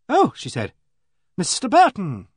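loud, high note of triumph; triumphant. A narrator's reading. Vicar's wife placing an acquaintance in the street, triumphant. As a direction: hail him as if you have solved it. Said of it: "She said it rather triumphantly".

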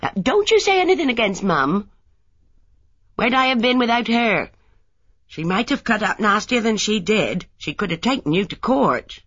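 put-on young wife sharply defending her mother; indignant defence. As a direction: bristle at a slight on Mum.

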